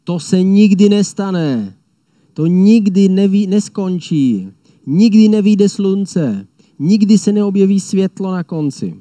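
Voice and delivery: the enemy lion yelling that nothing will end; shouted doom. Retold or imitated. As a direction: bellow the hopeless prophecy. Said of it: "Křičí".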